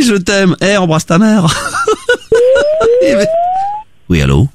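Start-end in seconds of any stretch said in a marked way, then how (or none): 1.5-3.8 long shrieking laugh; long high laugh. Real, not acted. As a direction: laugh until it squeaks. (long fou rire aigu)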